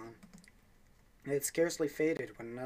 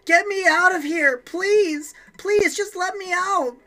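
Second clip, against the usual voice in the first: much higher, much louder, pleading shriek; desperate scream; 16 dB louder, slower than usual. Acted. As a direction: scream to be let out. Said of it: "pulling the screaming girl away".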